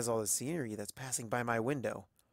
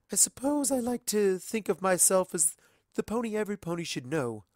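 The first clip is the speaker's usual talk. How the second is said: a gracious society mare welcoming a stranger; Rarity, elegant and gracious; 8 semitones higher than usual. A narrator's reading. Rarity speaking.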